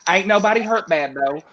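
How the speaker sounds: lightly drawled local reporter; Southern reassurance. Retold or imitated. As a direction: tell them it's fine, country style. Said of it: "you gotta say".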